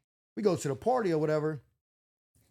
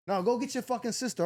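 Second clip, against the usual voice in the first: snapping; 6 semitones higher than usual. Retold or imitated.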